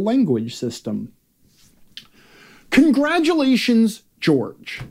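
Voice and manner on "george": ordinary talk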